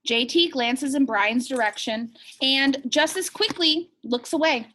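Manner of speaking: lively read-aloud to the class; animated storybook reading; story narration. A narrator's reading. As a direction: read it like a picture book for kids. Teacher reads picture book aloud.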